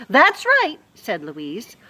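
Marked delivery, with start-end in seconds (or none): 0.1-0.8 high, loud sharp agreement; Louise's voice. Acted. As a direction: snap it out.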